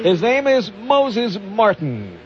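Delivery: formal radio news delivery; news anchor read. Acted. News read over music.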